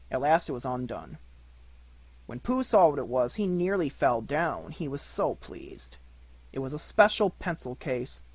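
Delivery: usual speaking voice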